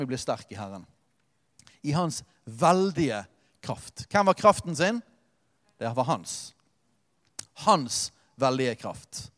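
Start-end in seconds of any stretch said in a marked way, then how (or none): 1.8-4.0 drawn out, «veldige» stressed; preacher savouring the words; slow, weighty. Real, not acted. Savouring the verse. 7.6-9.3 drawn out, «veldige» stressed; preacher savouring the words; slow, weighty. Real, not acted. Echoing the verse.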